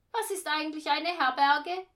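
put-on high, loud child voice; brash kid's question. A narrator's reading. Boy asks the rehearsal group about inns, loudly curious.

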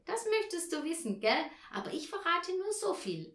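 put-on high-pitched girl guarding her secret; teasing little Tina. Tina teasing her parents about the present, playful. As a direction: play a child teasing, high and lively.

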